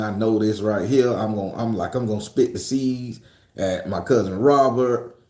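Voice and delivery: ordinary talk, nothing marked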